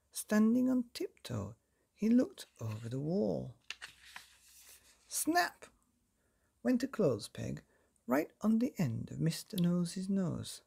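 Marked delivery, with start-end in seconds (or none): 5.1-5.7 sharp high snap word. A narrator's reading. Peg snapping shut, sharp high snap.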